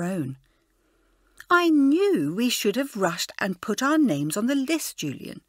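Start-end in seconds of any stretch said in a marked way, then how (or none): 1.5-5.4 a touch of cross complaint; understated sulky crossness; crossly. A narrator's reading. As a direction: grumble at her friend, mildly annoyed. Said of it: "she said crossly".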